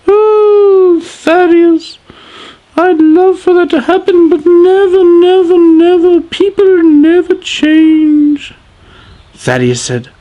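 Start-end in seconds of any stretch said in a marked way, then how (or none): 0.1-4.4 high wailing wife. Acted. Sadeas pleading with her husband, high and wailing. 4.4-6.2 each never pressed harder and louder; stressed repeated never. Acted. Wife insisting people never change, stressing never. 6.2-8.5 high wailing wife. Acted. Sadeas pleading with her husband, high and wailing.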